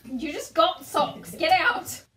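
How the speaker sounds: loud, drawn-out sibling telling-off; exasperated scolding. Real, not acted. Sister tells brother off for interrupting filming, exasperated scolding. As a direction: shoo out a pesky brother, loudly.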